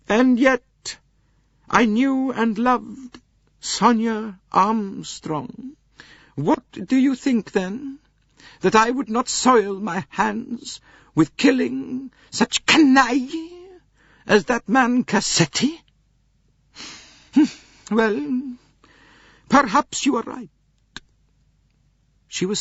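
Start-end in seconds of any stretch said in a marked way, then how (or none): 0.1-15.8 put-on high, slow haughty old lady; lofty aged princess voice. Princess voice held. 17.3-20.5 put-on high, slow haughty old lady; lofty aged princess voice. Princess voice held.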